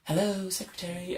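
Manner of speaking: mum's posh work-phone greeting. Retold or imitated. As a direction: put on the receptionist manner. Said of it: "It's, it's posh voice".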